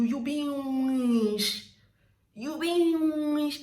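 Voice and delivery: loud, high "witch" stretched into "weesh"; drawn-out pronunciation. Shown as a demonstration. Telling off someone who provokes you, loud drawn-out insult. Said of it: "They draw it when they are saying it".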